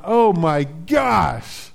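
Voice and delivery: drawn-out, panicked cry of dismay; mock panic. Pastor acting out dismay at bad news, drawn-out panic. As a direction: stretch it out like someone panicking. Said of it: "you're like, not".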